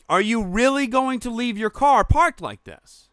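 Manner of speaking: high, slow, incredulous delivery; exasperated retold challenge. Driver repeats himself to the bad parker, exasperated. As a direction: spell it out slowly, pitched high.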